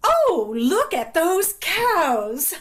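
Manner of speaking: high, loud, drawn-out exclamation; overdone excited aunt distracting children; marvelling aunt. A narrator's reading. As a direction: point it out as an amazing rarity. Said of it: "as though she were drawing attention to a rarity".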